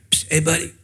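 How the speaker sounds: hushed street-vendor come-on, voice softened; imitated furtive whisper. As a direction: lightly mimic a vendor trying to catch someone discreetly. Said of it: "he's whispering to you".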